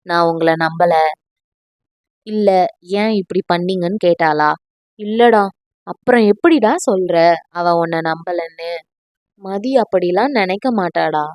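steady him with a lightly voiced friend's chiding; friend scolding. A narrator's reading.

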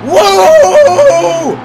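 very high, loud, drawn-out scream; alarmed whoa. Real, not acted. Player losing control of the car, alarmed scream.

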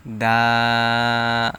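দা held long and steady; long drawn vowel. Shown as a demonstration. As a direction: pull দা out for three counts. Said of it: "তিন second পরিমাণ টান দিবেন". (দা টেনে লম্বা করে)

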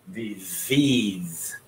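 disease with the stress on the second syllable, di-SEASE. Shown as a demonstration. Teacher showing students the stress in disease. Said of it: "Trọng âm rơi vào tiết thứ hai".

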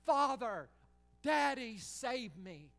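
high, loud cry of Father then a faltering save me; crying plea. Acted. The Son crying to his Father from Gethsemane, pleading. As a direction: cry out to your daddy for rescue. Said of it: "crying to his Father".

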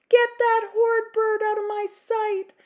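high, loud furious scream. Acted. Princess screaming at the plucked raven, high furious scream. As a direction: shriek it in disgust. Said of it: "she screamed".

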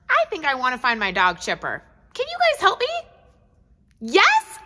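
ordinary talk

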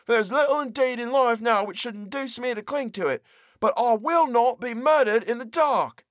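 put-on high, strained voice of a trapped young man; desperate prisoner. Trapped prisoner defies his unseen captors, high and strained. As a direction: plead and defy like a man locked in the dark.

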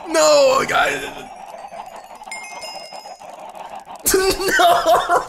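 loud, high cry of dismay; anguished wail. Real, not acted. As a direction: wail no at a sudden disaster. Gamer wails at a disaster.

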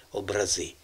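"образи" with ЗИ strong; "образи" as обраЗИ, final syllable stressed; stress on last syllable. Shown as a demonstration. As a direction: land on ЗИ in "образи". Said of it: "наголос ми поставили".

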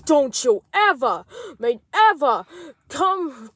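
loud scolding start; loud angry telling-off. Acted. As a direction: tell him off loudly. Chef Fox scolds Junior.

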